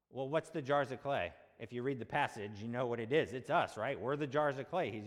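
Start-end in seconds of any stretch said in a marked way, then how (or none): none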